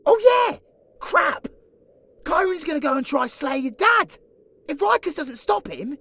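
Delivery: loud and high, bursting out in alarm; sudden alarm. Acted. Scarly realising the dad is in danger, loud alarmed outburst. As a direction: blurt it out as it dawns on you.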